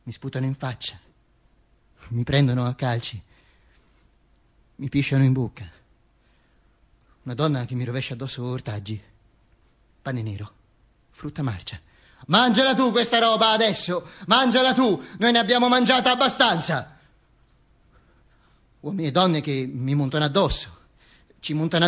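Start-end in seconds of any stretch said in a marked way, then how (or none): 0.0-11.8 softer, lower, grim; hushed. Acted. The dead Duce recalls the abuse in a hush. Describing the lynching. 12.3-17.0 higher, scornful mock taunt; bitter taunt. The woman taunts the Duce with rotten food.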